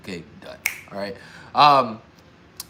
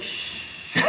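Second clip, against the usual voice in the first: long hushing "shh"; God's shushing; 9 dB quieter than usual. Retold or imitated. God hushing him in a retold moment, drawn-out shush. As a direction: shush them softly, playing God. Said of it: "God was like".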